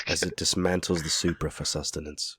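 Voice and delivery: hushed wildlife narrator; nature documentary. Acted. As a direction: murmur like a nature film. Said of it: "Here we see ... in the wild".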